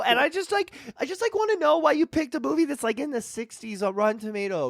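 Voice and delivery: whiny little fan asking; high fan voice. Acted. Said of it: "What was the fan's comment".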